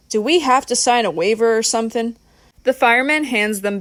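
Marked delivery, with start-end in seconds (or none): none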